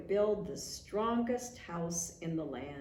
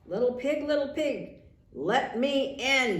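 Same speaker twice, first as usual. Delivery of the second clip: growling wolf; 5 semitones higher, 7 dB louder than usual. A narrator's reading. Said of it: "he growled".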